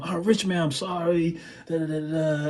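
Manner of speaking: soft, meek mock apology; retold scared apology. Drummer apologizing after the gig, retold meek voice. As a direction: mumble a timid sorry, imitating yourself. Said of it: "I'm scared to death".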